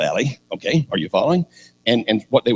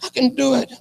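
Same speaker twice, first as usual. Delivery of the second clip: put-on very high, small kid voice; little boy. Preacher plays small boy volunteering, high boyish voice. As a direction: pipe up as a plucky youngster. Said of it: "He's this little dude".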